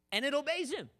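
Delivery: high, incredulous exclamation; loud amazement. Acted. Preacher marveling that the storm obeys, loud high amazement.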